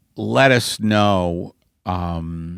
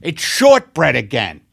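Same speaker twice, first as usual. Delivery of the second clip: mock outburst; 7 semitones higher, 3 dB louder than usual. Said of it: "It's like".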